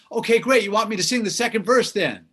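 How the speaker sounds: high, eager star impression. Singer offering to take the second verse, eager high impression. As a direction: offer keenly in a high imitated voice. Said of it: "That's my [name] impression".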